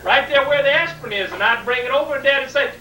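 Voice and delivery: normal talking voice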